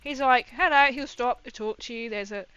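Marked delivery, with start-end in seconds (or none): 0.5-0.9 imitated friendly hello, raised and loud; loud, higher retold greeting; cheery greeting. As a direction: call hello like the friendly visitor. Retelling friendly visitor's greeting.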